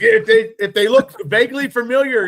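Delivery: high, loud teasing disbelief; incredulous mock outrage. Real, not acted. Teasing a friend about smacking strangers, high and loud. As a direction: rib him, voice climbing in mock shock.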